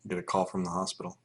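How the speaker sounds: ordinary talk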